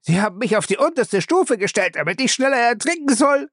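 high, wailing panic. Acted. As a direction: howl it out in terror. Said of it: "heulte Lacey".

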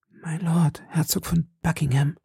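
high, whispered confidence; half aloud. A narrator's reading. Madame Bonacieux revealing the Duke's identity, hushed. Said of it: "sagte Madame Bonacieux halblaut".

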